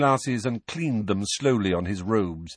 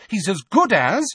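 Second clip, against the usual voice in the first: high, loud fierce retort; 12 semitones higher, 8 dB louder than usual. A narrator's reading. Mrs Weasley insists he is as good as her son, fierce. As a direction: snap back fiercely, pitched up. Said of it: "said Missus Weasley fiercely".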